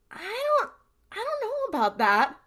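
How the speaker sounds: lightly voiced gruff uncle, doubtful and high; a hint of old Bobby squinting at the list; skeptical Bobby. Bobby doubting the ingredient list, lightly hammed-up.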